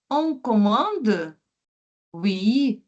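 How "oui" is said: ordinary talk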